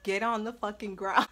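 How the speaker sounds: ordinary talk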